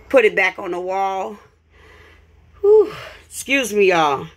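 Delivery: winded and out of breath; out of breath after the climb. Real, not acted. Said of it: "Let me catch my breath".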